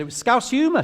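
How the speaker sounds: mum's excuse, pitched well up; Liverpudlian mum. Retold or imitated. Liverpudlian mum excusing her put-down as humour, high protesting voice. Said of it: "she's Liverpudlian".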